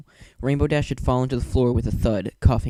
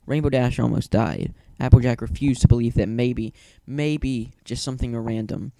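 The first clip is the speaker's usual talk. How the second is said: plain reading voice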